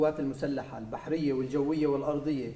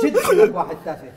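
much louder scolding bark; angry scolding; 10 dB louder than usual. Acted. Father scolding his son, angry shout. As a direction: scold your useless son.

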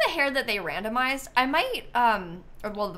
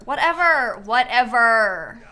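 exasperated drawn-out whatever; 9 dB louder, slower than usual. Pretend. Dismissive whatever, drawn out louder.

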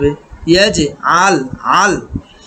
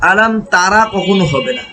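ordinary talk

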